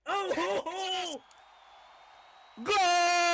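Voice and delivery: loud mock soccer goal call; shouted goal. Real, not acted. Commentator celebrating the move for the crowd, shouting. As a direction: yell it like a soccer caller.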